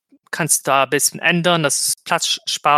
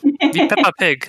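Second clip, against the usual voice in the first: playful high voice; 11 semitones higher, 4 dB louder than usual. Acted. Jokes about the word Pepakura.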